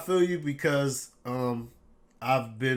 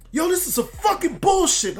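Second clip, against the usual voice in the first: high, loud mock yell of an angry friend; retold yelling; 6 dB louder than usual. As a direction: imitate a furious fan yelling down the phone. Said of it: "he was just yelling through the phone".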